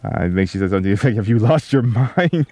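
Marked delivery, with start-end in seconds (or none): 1.1-2.5 retold question, higher, breaking into a laugh; laughing quote. Father quoting his wife's reaction to his outburst, laughing. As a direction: imitate an appalled question, laughing through the end.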